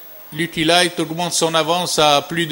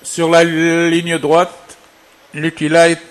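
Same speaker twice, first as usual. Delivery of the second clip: race caller; 3 dB louder than usual. Real, not acted. Live horse race call, final turn.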